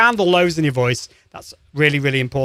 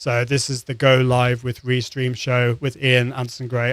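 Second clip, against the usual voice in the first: low, level, boring monotone; bored presenter droning on one note; flat monotone; 5 semitones lower than usual. Shown as a demonstration. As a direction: drone it out with no pitch movement. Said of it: "They're speaking on a monotone like this".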